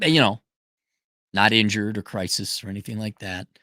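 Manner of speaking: normal talking voice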